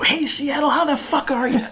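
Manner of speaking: high, brash shout-out to the crowd; mock stage greeting. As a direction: playfully show the cheeky opener. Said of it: "Walk out and go".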